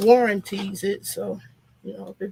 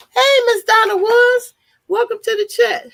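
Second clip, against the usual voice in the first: excited greeting; 11 semitones higher, 9 dB louder than usual. Real, not acted. Greeting a viewer in live chat.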